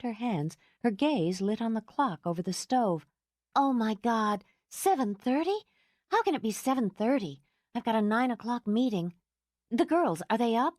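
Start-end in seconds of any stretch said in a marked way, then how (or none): 3.5-10.7 panicked. Acted. Laura panics at clock.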